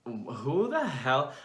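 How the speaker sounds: ordinary delivery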